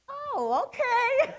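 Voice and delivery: very high, loud squeak; comically high-pitched reply; acted sudden realization. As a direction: re-enact a squeaky, startled agreement. Acts out her sudden, comic relief.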